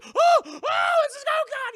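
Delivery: loud, very high mock-panicked wail; freaking out. Character sees himself turning to wood, acted panicked scream. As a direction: shout it drawn out, imitating horror. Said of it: "he starts freaking out".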